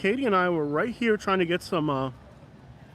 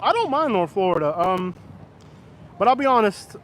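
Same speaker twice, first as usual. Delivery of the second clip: ordinary talk, nothing marked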